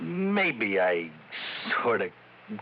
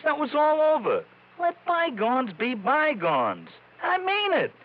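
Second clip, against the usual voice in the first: high, slow and urgent, begging to be believed; insistent pleading; 12 semitones higher, slower than usual. Acted. Ex-con insists to the investigator he's gone straight, high and pleading. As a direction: push every word, near desperate. Gangster pleading his innocence.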